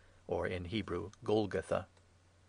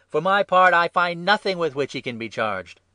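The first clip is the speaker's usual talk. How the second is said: raised, emphatic protest; loud retort; 9 semitones higher, 11 dB louder than usual. A narrator's reading. Pilate protesting to the priests, loud and emphatic. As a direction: declare it over a shouting crowd. Pilate argues with shouting crowd.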